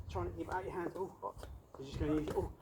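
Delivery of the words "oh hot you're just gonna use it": ordinary talk, nothing marked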